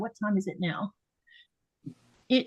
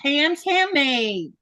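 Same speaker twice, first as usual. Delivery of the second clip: hyped giveaway announcement; 5 semitones higher than usual. Acted. Announces the giveaway wheel winner.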